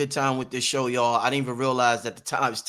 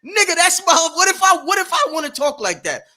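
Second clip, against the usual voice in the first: much higher and louder, demanding an answer; defiant shout; 13 semitones higher, 6 dB louder than usual. Real, not acted. Host answers the critics who police his talk, defiant shout.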